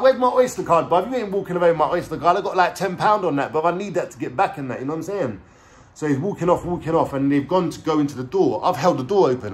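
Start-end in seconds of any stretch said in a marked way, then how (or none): 0.0-4.8 high, indignant retold demand; raised, agitated voice easing down by the end; angry demand. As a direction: act out demanding your property back. Acted quarrel over lost card.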